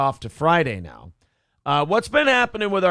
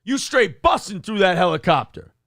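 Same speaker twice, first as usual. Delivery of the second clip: hyped-up bro voice; 7 semitones higher, 5 dB louder than usual. Retold or imitated. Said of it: "Yeah, just like".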